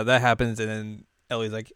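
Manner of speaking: ordinary talk, nothing marked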